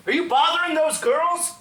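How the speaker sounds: high, loud accusing question; confronting. Retold or imitated. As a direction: challenge them at full voice. Confronts the British boys.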